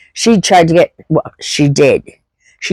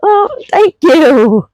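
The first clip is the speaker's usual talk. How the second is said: much higher, slowed gushing thanks; retelling her own gushing reply; 6 semitones higher than usual. Retold or imitated. Thanking the friend's mother for her apology, high and gushing. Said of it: "And I was like".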